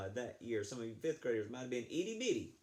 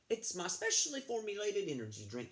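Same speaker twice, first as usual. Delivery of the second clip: lifted, eager runner's voice; higher Katerina voice; 9 semitones higher than usual. Acted. Katerina tells Josh about her energy drink, higher voice. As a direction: sell your energy drink up high.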